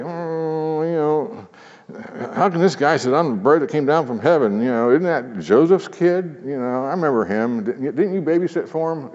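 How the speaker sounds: mocking high voice of the doubters; grumbling crowd. Preacher mimicking the grumbling crowd in a mocking high voice. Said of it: "they're saying".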